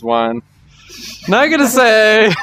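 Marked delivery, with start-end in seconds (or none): 1.3-2.4 high giggly teasing refusal; coy, laughing it off; laughing tease. Real, not acted.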